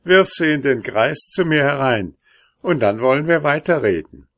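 ordinary delivery

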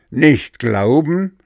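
high, slow, outraged question; indignant outburst. A narrator's reading. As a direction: throw the words back in disbelief. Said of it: "rief der Oger entrüstet".

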